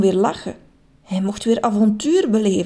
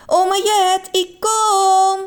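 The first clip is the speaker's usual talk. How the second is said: high, louder call; eager call; 11 semitones higher, 4 dB louder, much slower than usual. A narrator's reading. Childlike man calling to grandma as he jumps in, excited. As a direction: shout with joy. Mr Just calls out.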